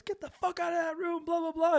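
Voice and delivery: high-pitched mock scolding; mock angry yell. Roommate ordering someone out, high mock angry yell. Roommate yelling at laughing friend.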